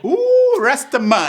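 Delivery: high, loud whooping exclamation; excited outburst. Real, not acted. Co-host cheering the Rasta letter writer, excited outburst. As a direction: whoop it out with a big drawn-out ooh.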